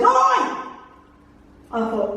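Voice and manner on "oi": high startled shout; a sudden high Oi. Retold or imitated. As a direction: yell after the figure. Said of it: "He goes".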